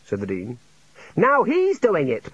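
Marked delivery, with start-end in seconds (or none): 1.2-2.3 high, stretched accusation; frantic yell. A narrator's reading. Junior wizard accusing the Dean, yelling frantically. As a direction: cry out in outraged disbelief. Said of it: "Ponder yelled".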